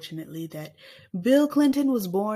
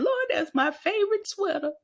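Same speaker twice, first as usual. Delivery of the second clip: high mock wailing voice; mock crying wail; 11 semitones higher than usual. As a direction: wail like losing a sweater. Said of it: "everybody would be crying".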